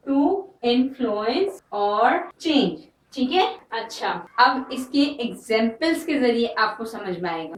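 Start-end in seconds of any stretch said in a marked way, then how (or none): 0.1-2.8 very slow, word by word; slow dictation. Shown as a demonstration. Teacher dictating the definition to students, very slow. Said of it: "definition है इसकी".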